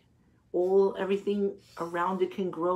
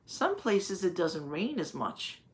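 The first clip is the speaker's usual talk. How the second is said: normal talking voice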